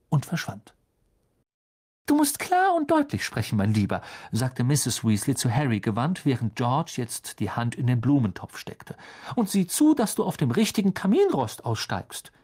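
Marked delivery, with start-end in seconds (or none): 2.1-4.0 high, sing-song motherly voice; a kind mother ushering the guest first; fussing mother. A narrator's reading. Mrs Weasley voiced by narrator. 9.4-12.3 high, sing-song motherly voice; a kind mother ushering the guest first; fussing mother. A narrator's reading. Mrs Weasley voiced by narrator.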